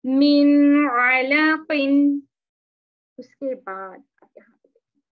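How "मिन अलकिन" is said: मिन अलकिन very slow and much louder in a chanting voice; izhar recitation. Shown as a demonstration. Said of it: "तो कैसे पढ़ेंगे इसको?".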